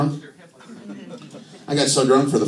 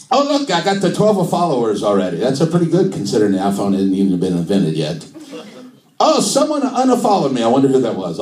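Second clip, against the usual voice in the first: put-on animated comic Italian accent; mock Italian, jumping up in pitch; Italian-accented Jesus. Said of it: "do Jesus in an Italian accent".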